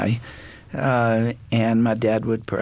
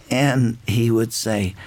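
ordinary talk

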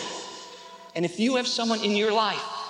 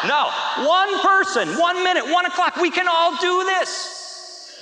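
high, loud, drawn-out correction; mock exasperated insistence; 8 semitones higher, 4 dB louder, slower than usual. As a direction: lay down the rule slowly, comically loud. Retold comic insistence to overachiever.

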